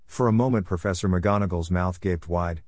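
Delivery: plain reading voice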